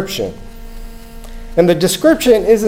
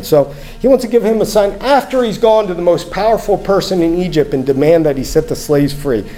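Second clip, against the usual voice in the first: normal talking voice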